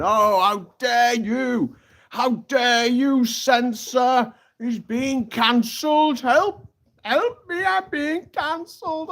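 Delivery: high, slow, wailing mock-victim voice; whiny right-wing press. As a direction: ham up a melodramatic whine about being cancelled.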